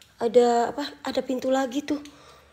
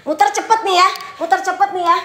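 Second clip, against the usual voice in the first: high, loud, insistent announcement; loud challenge; 8 semitones higher, 8 dB louder than usual. Real, not acted. Streamer showing doubting viewers a spin, loud challenge. Defiantly answers viewers' demands.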